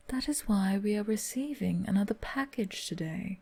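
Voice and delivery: ordinary talk, nothing marked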